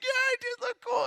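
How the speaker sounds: put-on a small boy suddenly proud of himself; kid brother voice. Little brother's proud reply.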